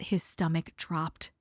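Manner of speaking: low ominous hush. Acted. Ominous beat in ghost story.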